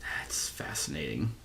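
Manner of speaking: hushed, voiceless murmur to self; very soft aside; whispered musing. Real, not acted. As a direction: whisper it while absorbed in reading. Murmurs to self reading card.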